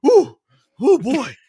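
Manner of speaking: high squeaky panicked whale; squealing whale. Pretend. Whale voiced in silly bit.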